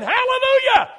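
loud, high exultant cry; joyful hallelujah. Retold or imitated. Preacher retelling his own loud joyful hallelujah. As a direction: burst out praising. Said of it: "And I'm saying".

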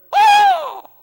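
very high loud "Oh!"; a sudden high, loud preacher's "Oh!"; shocked outcry. Real, not acted. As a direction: cry out in shock. Preacher's exclamation at vultures.

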